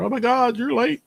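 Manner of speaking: high, squeaky mock complaint from impatient listeners; teasing the audience in a squeaky mock whine; mock holler. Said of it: "scream at me and holler".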